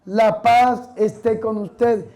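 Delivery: slow, measured greeting of peace; priestly greeting. Acted. Priest quoting the risen Lord's greeting to disciples, slow and measured. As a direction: give the blessing unhurriedly, word by word. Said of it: "Ese es el saludo que damos los curas".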